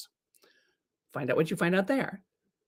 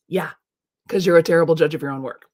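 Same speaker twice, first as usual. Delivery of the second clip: ordinary talk, nothing marked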